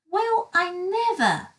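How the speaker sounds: high, astonished character voice; astonished cry. Acted. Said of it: "cried Mister Happy".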